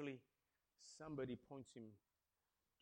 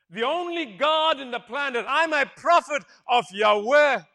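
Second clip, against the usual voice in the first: loud, higher, slow proclamation; bold declaration; 8 semitones higher, 21 dB louder, slower than usual. Retold or imitated. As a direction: proclaim it to the king. Balaam's shouted declaration.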